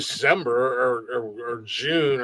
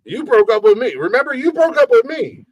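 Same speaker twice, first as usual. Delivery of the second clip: loud, high, exasperated comeback; indignant reminder; 10 semitones higher, 7 dB louder than usual. Retold or imitated. Reminding an ex who ended it, loud indignation. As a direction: act out throwing it back at an ex. Retold argument with ex.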